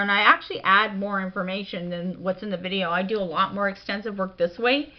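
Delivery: ordinary talk, nothing marked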